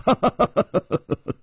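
acted laugh. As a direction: laugh in a run of ha-ha's. Hero laughs mid-reply. (一串渐落的哈哈笑)

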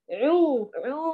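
عو forced through the nose as the error; a nasal, muffled عو to show the mistake. Shown as a demonstration. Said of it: "بهذا الصوت الغنة الذي من الخيشوم".

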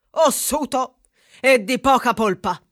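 ordinary talk

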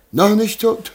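high, loud incredulous question; can't believe he still lives; shocked disbelief. Acted. Merchant to servant, shocked the old man lives.

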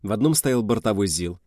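usual speaking voice

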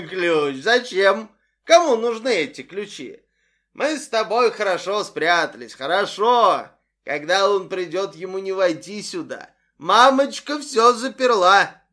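put-on loud high drunken rambling; drunk mother. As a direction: babble giddy and drunk. (пьяная мать, громко и бессвязно)

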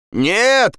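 high cry of protest; shouting. Acted. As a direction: shout a high, urgent refusal. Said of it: "крикнул Андрес". (крик протеста)

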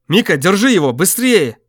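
loud, high urgent yell; urgent shout. Acted. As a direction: call out for help fast. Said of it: "закричал Гюрза".

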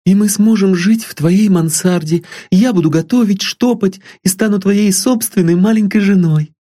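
put-on high, eager young girl pleading sweetly; a lovesick girl dreaming of a home; high girlish voice full of hope; young girl Sylvia. A narrator's reading.

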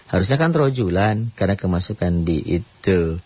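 normal talking voice

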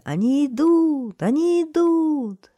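high, loud, drawn-out joyful cry; crowd shouting. A narrator's reading. Townspeople cheering their whiners' arrival, joyful shouting. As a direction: shout the news, stretching the words. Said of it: "закричали все".

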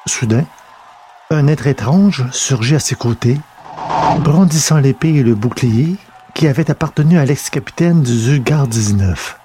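usual speaking voice

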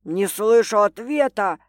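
loud barked demand. A narrator's reading. Man demanding an answer, loud barked. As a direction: bark it at her. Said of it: "рявкнул он".

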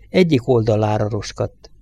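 ordinary delivery, nothing marked

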